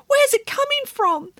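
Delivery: very high panicked piglet. A narrator's reading. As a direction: squeak it in panic. Piglet Gretel voiced, panicked.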